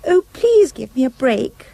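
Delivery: lightly voiced frightened girl begging; pleading cry. A narrator's reading. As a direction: plead for your life. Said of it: "She cried".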